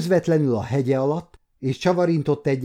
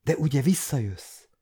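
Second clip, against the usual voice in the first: almost begging; 2 semitones higher than usual. Acted. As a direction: gently beg him to come back. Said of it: "mondta Marcella majdnem könyörögve".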